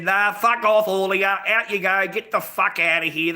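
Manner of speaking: forceful mock Irish telling-off; Irish lad. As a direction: imitate an Irish lad ordering people off. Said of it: "that's the Irish lad".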